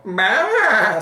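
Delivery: high, loud sheep bleat; sheep baa. A narrator's reading. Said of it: "said the sheep".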